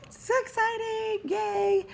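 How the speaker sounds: squealed high and sing-song; mock excitement. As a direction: gush with over-the-top glee. Mock cheer about a book.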